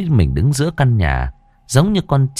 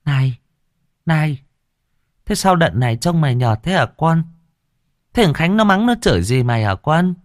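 caring mother-in-law; 4 semitones higher than usual. A narrator's reading. Mother-in-law worried about daughter.

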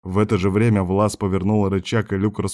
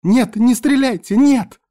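high, loud frantic plea; panicked girl's cry at top pitch; desperate screaming; 7 dB louder, slower than usual. A narrator's reading. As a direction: scream to stop them firing. Girl screams to stop shooting.

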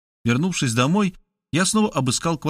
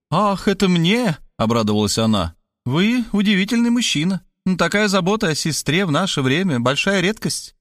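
higher, delighted woman's voice; lightly voiced pushy woman, flattered; flattered Markhaleva; 7 semitones higher than usual. A narrator's reading. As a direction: gush a little, pleased and coy.

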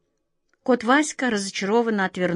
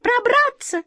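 very high tiny mouse voice; high-pitched squeaky little creature; mouse squeak; slower than usual. A narrator's reading. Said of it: "пискнула вторая".

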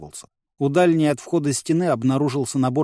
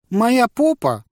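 high, tearful whine; whiny crybaby voice; 10 semitones higher, 4 dB louder, slower than usual. A narrator's reading. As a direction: whimper it like a hurt child. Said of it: "плаксивым голосом ответила Мадна".

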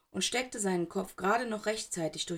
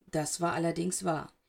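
ordinary delivery, nothing marked

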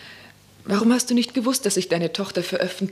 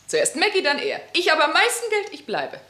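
higher mocking sing-song; bitter mockery; 7 semitones higher than usual. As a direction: sneer up at them, pitching it high.

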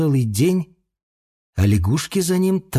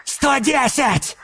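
high, slow eager bid; excited shout; 12 semitones higher, much slower than usual. A narrator's reading. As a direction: shout the bid with gambling fever. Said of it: "азартно выкрикнул Фоса".

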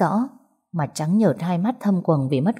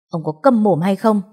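moderately raised, scolding voice; angry yell; 3 semitones higher, 4 dB louder than usual. A narrator's reading. Arrested woman turns on her drunk boyfriend, moderate angry yell. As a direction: snap back at him to shut him up. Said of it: "quay lại quát lớn".

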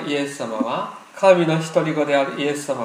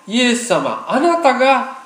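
raised-voice declaration; 6 semitones higher than usual. Shown as a demonstration. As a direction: proclaim it for all to repeat, rising in pitch. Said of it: "大きな声で言いましょう".

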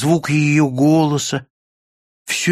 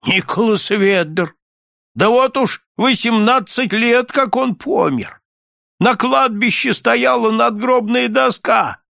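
put-on high, thin elderly voice; thin, husky old man; 7 semitones higher than usual. A narrator's reading. Old man recalls a long-dead neighbour, thin husky voice. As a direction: answer in an old man's high, reedy voice. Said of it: "старик ответил тонким, сиплым голосом".

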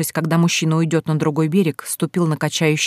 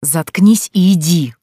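slow, angry, hissed order; 4 dB louder, slower than usual. A narrator's reading. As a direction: hiss it out through the teeth. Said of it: "зло прошипел он".

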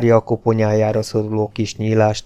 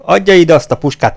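higher, louder angry order; angry demand; 7 semitones higher, 7 dB louder than usual. Acted. The baron, losing patience, orders Hufeisen to hand over the rifle, angry and loud. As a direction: snap the demand out for the last time.

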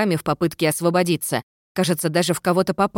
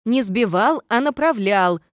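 confident; 6 semitones higher, 3 dB louder, slower than usual. A narrator's reading. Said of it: "уверенно сообщил призрак".

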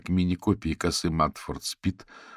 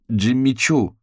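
higher, louder scoffing correction; disdainful, raised-voice correction; scornful snort; 7 semitones higher, 7 dB louder, slower than usual. A narrator's reading. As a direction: scoff the brand name at a friend. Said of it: "фыркнула Софи".

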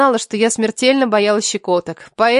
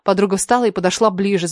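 plain reading voice

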